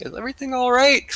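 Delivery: high, quick worried crewman; nervous crew member. Retold or imitated. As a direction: peek in nervously, voice jumping up.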